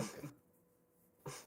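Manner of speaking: muttered under the breath. Acted. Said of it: "under my breath a little bit".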